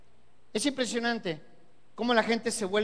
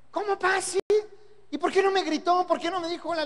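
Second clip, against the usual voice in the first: higher, outraged son protesting; indignant young son; 8 semitones higher than usual. Retold or imitated. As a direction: play the hurt young man asking why. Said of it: "su respuesta no fue".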